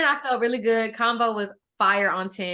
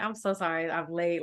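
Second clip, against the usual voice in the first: quiet apology; 7 semitones lower, 9 dB quieter than usual. Retold or imitated. Apologizing on the phone.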